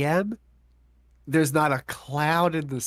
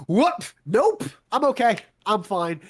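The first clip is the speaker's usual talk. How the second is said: high, startled what then a quick nope; shocked refusal; 9 semitones higher, 3 dB louder, slower than usual. Retold or imitated. Man hears nine hundred dollar bill, shocked refusal. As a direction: jump up in alarm at the price. Hearing the ambulance cost.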